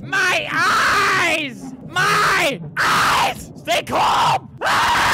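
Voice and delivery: loud, high, drawn-out screams; hammed-up shrieks of agony; agonized screaming. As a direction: scream as if blinded, building to a shriek. Player blinded, screaming in game.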